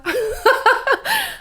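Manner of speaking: high string of laughs. Real, not acted. Host laughs at own question.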